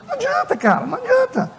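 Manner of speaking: high, exasperated protest. Acted. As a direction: throw up your hands, it's hopeless. Pastor exasperated at nagging believer.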